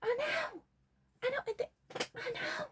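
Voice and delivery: very high, thin squeal of delight; squealed agreement. Pretend. Squealing agreement about radiant client.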